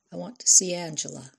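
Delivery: ordinary delivery, nothing marked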